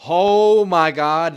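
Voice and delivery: loud, high, long drawn-out "oh my God"; exasperated outcry. Real, not acted. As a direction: cry out in slow, stretched-out disbelief. Exasperated outburst at a bad accent.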